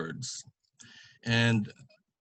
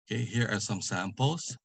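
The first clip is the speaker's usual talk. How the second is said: normal talking voice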